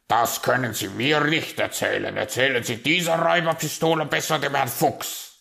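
loud, brusque raven voice; dismissive raven. Acted. Raven rejecting the magpie's tall story, dismissive. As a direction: wave the tall tale away loudly.

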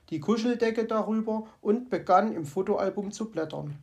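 usual speaking voice

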